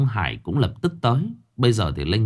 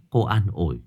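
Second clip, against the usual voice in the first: ordinary delivery, nothing marked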